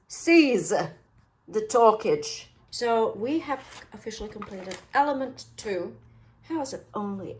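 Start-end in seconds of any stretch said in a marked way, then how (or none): 0.1-2.5 mock-grand drawl. Crafter cutting her own chatter, very slow and loud. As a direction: seize the talkage said very slowly and loudly. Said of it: "mock-grand phrase, very slow".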